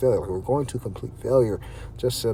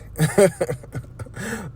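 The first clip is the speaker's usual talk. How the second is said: laugh; 8 semitones higher than usual. Real, not acted. Laughs at own bedroom joke.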